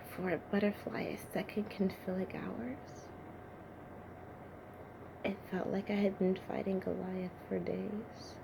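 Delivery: soft, reflective wonder; dazed hush. Acted. David dazed after fighting Goliath, hushed and reflective. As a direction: pull right back, quietly lost in thought.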